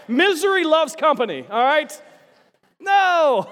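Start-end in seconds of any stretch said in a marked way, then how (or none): 2.8-3.5 mock outrage. Real, not acted. Crowd answer, mock outrage.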